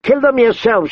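high-pitched, sharp refusal; defiant retort. A narrator's reading. Woman refusing her companion's order, defiant. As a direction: throw the order straight back.

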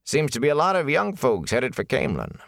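high-pitched innkeeper voice. A narrator's reading. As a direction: play the innkeeper, pitched well above the narration. Innkeeper's speech in narrated story.

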